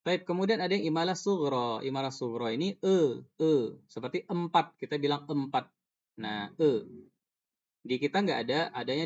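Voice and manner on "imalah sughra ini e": ordinary talk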